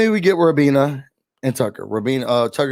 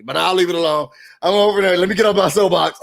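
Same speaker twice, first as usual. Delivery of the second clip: ordinary talk, nothing marked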